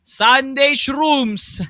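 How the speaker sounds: loud hype commentary, some words stretched; esports caster. Real, not acted. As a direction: shout the call over the crowd. Second caster calling the decisive fight.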